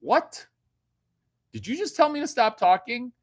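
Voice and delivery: high, loud indignant outburst; mock outrage. To the woman who shushed him, hammed-up outrage. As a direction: act insulted, pitch shooting up.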